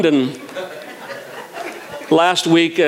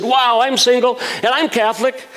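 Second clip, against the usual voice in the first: higher, eager burst of delight; excited taxi driver; 10 semitones higher than usual. Retold or imitated. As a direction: blurt it out thrilled, like a wish come true. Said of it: "And he got really excited".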